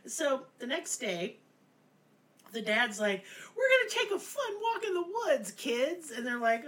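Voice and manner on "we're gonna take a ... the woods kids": high, sing-song fake cheer; fake cheerful dad. Dad luring the kids into the woods, high fake cheer. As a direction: sell the walk like a shady dad.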